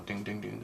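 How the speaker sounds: soft, hushed ding ding ding imitating metal bouncing; lug nut dings. Driver mimicking lug nuts flying off his car, soft imitated dings. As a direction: tap the dings out quietly, like nuts hitting asphalt.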